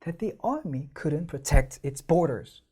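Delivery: very slow, army clearly stressed; stressed reading. Shown as a demonstration. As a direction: read it slowly, lightly marking stresses. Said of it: "다 들어가 있거든요".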